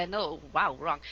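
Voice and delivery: ordinary talk, nothing marked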